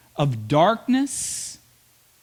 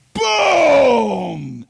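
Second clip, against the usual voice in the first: loud, higher shouted boom; dramatic boom; 6 semitones higher, 7 dB louder than usual. Acted. Preacher showing the sea crashing on the Egyptians, loud shouted boom. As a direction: slam the word down like crashing water.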